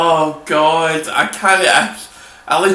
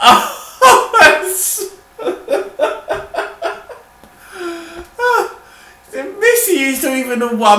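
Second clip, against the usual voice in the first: laughing; 10 semitones higher than usual. Real, not acted. Laughing fit.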